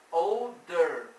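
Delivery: put-on older said loud with a falling tune; stressed example word. Shown as a demonstration. Teacher pointing out the er ending, loud and stressed. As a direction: lean hard on older for the class. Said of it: "esse er aqui é um mais".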